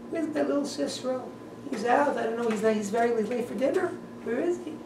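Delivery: high, anxious fretting mother voice; quiet, high-pitched worried mommy. Retold or imitated. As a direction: fret like a mother waiting up for her son. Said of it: "his mommy, doesn't know where he is".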